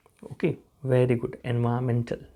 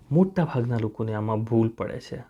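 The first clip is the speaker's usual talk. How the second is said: ordinary delivery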